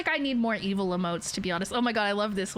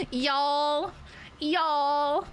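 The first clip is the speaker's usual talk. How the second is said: higher, drawn-out Southern "y'all"; Southern accent; 5 semitones higher than usual. Acted. Streamer playing with a word she uses, mock Southern accent. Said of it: "in a Southern accent".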